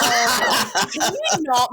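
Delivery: loud, laughing "can you not"; laughing protest. Real, not acted. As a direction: shout him down in mock outrage. Protests joke about her mother.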